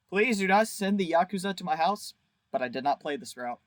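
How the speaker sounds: ordinary talk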